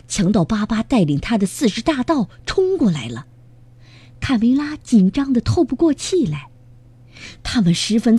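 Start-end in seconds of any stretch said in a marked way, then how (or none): none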